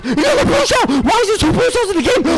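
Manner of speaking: very high, loud screaming; shrieking outrage. Real, not acted. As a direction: shriek in disbelief at the game. Second blue shell hits.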